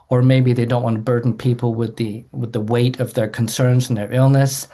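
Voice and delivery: ordinary delivery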